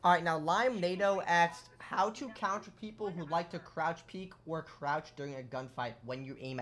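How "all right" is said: normal talking voice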